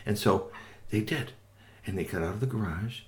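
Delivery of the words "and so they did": usual speaking voice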